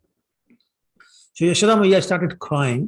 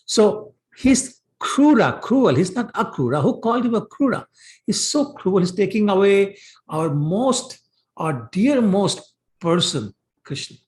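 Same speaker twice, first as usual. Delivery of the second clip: indignant cursing; 6 semitones higher than usual. Retold or imitated. Gopis curse the man taking Krishna, acted indignation. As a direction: accuse him in an acted, aggrieved high voice. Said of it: "then they started cursing".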